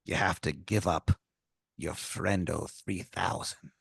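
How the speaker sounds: quiet pirate deal; pirate tomato. Acted. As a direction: drop the price quietly. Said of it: "He goes".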